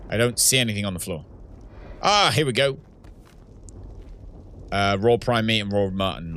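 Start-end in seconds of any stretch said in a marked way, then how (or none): none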